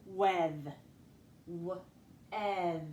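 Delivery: "weth" said whole, then "W-" and "ev" apart; segmented syllable. Shown as a demonstration. Teacher tackling the first syllable, "weth" sounded out in parts. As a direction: sound out "weth" piece by piece with long pauses. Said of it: "let's tackle that first syllable".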